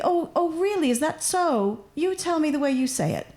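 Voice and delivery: high, curious oh really; lightly voiced curious learner; mock polite interest. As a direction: feign eager interest in their version. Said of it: "imitated reply to a corrector".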